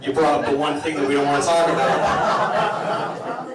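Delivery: normal talking voice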